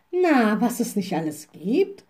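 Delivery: called-out mock amazement; exclaiming. A narrator's reading. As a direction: exclaim it as the little witch. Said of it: "rief die kleine". (staunend ausgerufen)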